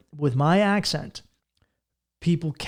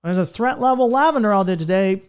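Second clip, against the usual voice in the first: mock nerd; 6 semitones higher, 5 dB louder than usual. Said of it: "And you're like".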